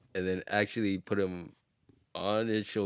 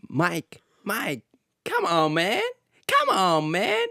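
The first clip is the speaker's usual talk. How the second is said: high, pleading voice; Chris Tucker impression; 10 semitones higher, slower than usual. Chris Tucker pleading with Michael, high excited voice. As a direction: beg Mike to come on, pitched up. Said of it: "the way Chris Tucker".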